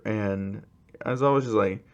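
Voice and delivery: ordinary delivery, nothing marked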